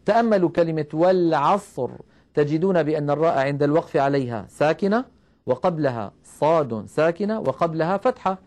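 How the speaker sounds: usual speaking voice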